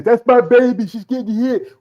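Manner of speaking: high-pitched, loud protective dad; worried dad. Acted. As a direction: cry out like an alarmed father. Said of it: "Your dad says".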